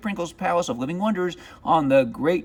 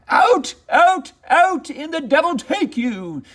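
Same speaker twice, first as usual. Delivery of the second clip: much higher and louder roar; furious bellow; 13 semitones higher, 4 dB louder than usual. Acted. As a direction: bellow at the dog in a rage. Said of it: "he bellowed".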